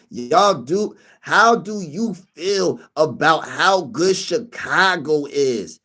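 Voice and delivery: very slow, drawn-out mock of the commenter's question; imitating a viewer. Teasing his homie by replaying his question, slow mocking voice. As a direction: drag it out like a lazy taunt. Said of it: "He said".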